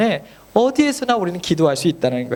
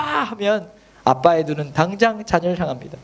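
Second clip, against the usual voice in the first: ordinary delivery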